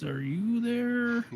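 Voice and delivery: high, slow, eerie calling; creepy person voice. Pretend. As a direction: creep someone out from the dark. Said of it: "Creepy person voice".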